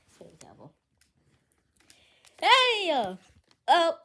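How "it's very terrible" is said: quiet. Shown as a demonstration. Repeats line, second much softer.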